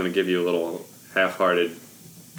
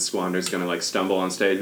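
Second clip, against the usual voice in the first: normal talking voice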